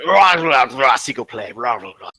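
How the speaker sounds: growly rah-rah gibberish, falling away; mock creature language. Player imitating an unknown tongue, mock creature language. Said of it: "it sounds like".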